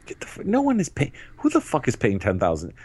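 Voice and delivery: sudden indignant outburst; angry outburst. Real, not acted. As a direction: flare up in disbelief. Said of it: "Whoa, calm down".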